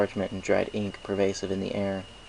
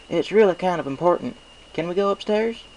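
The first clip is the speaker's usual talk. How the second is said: high, louder earnest country voice; country mare Applejack; 10 semitones higher, 6 dB louder than usual. Acted. Applejack asks Spike to go upstairs, earnest and high. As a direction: press politely but firmly.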